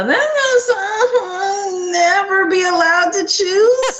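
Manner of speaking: high, slow, half-laughing cry of dismay; shocked laughing cry. Real, not acted. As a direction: react as if the choice is unbearable. Shocked by a hard choice.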